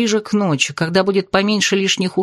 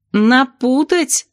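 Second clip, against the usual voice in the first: long drawn-out rising wail; wailed question; 6 semitones higher, much slower than usual. A narrator's reading. Narrator appalled the potion may be botched, wailing. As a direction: howl НАПУТАТЬ slowly, pitch climbing. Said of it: "взвыла я".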